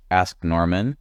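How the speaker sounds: plain reading voice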